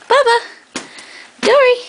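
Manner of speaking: high, loud call across the yard; calling the dogs. Real, not acted.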